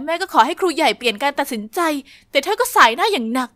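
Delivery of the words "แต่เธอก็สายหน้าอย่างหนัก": plain reading voice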